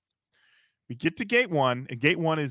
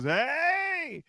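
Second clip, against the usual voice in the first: high, drawn-out mock hey; excited greeting; 13 semitones higher than usual. Retold greeting from arriving friend.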